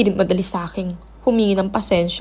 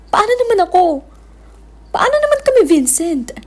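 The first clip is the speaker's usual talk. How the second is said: anguished outcry; 9 semitones higher than usual. Acted. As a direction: cry out in jealous pain. Jealous sister cries out. (sigaw na puno ng sakit)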